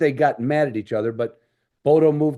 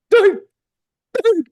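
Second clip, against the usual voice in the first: sharp high barking chop; dog chop bark; 7 dB louder than usual. Retold or imitated. S0 imitates the dog's chopping bark at the clinic. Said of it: "that chop".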